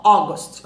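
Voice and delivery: August said with an O sound, "ogost"; pronunciation. Shown as a demonstration. Teacher shows how August really sounds. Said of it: "escreve com A e fala som de O".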